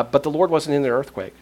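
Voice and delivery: ordinary delivery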